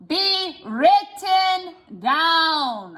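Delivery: very slow, stretched words. Shown as a demonstration. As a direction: drag every word out. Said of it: "I'm going to slow".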